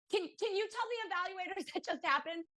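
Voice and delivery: high-pitched retold plea with a laugh; half-laughing plea. Parent asking staff to report the scream, eagerly pleading.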